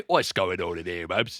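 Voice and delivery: hammed-up Cockney accent; mock Cockney. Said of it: "They're all, like, Cockney".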